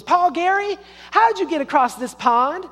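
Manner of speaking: put-on very high, loud girlish call; high-pitched, hammed-up little girl question; little sister calling out. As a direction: voice a kid sister calling over a pond. Little sister calls across the pond.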